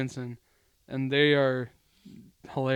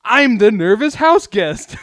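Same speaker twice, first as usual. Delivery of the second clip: slow, high, loud announcement; excited little cousin; 7 semitones higher, 12 dB louder, slower than usual. Retold or imitated. Little cousin runs up to announce his role, loud proclamation. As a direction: proclaim it big, imitating a kid. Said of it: "Jensen comes running around. He's like".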